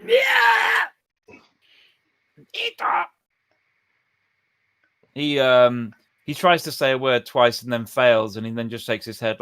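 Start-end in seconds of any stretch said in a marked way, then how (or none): 0.1-3.0 high, strained shriek; screeched demon attempts, falling away in volume; Abyssal creature. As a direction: squawk the words as if unable to finish. Creature struggles to answer in Abyssal.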